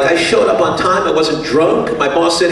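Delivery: ordinary talk, nothing marked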